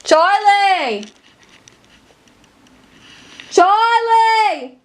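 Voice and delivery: loud high call. Real, not acted. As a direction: shout the name across the house. Calling sister from another room.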